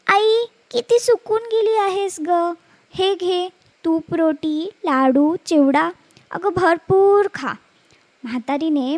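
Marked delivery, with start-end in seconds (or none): 0.1-7.5 higher, slow and tender daughter's voice; fond daughter fussing over her mother; loving daughter. Acted. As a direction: coax your mother to eat, lovingly. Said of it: "लेक तिला मोठ्या प्रेमाने म्हणाली".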